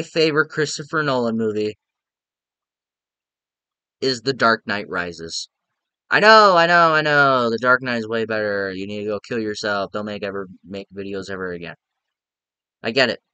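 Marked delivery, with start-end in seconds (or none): 6.1-7.5 exasperated pre-empting. Real, not acted. Heading off commenters who prefer another film, loud exasperation. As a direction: cut off the objectors loudly. Heads off objections to his pick.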